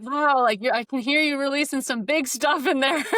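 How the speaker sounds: lightly voiced husband; husband's reaction. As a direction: play the husband, amused and impressed. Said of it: "and he'll be like".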